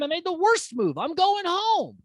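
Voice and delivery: high, dismayed mock wail; hammed-up despair, pitch soaring; mock panic. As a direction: wail it as if all is lost, dragging the end. Acting Jed's dismay at his blunder.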